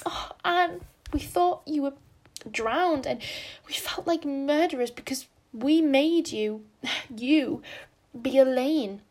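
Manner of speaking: tearful relief. A narrator's reading. Diana clinging to Anne, high tearful relief. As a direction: pour out relief and guilt in a rush.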